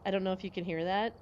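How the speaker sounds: ordinary delivery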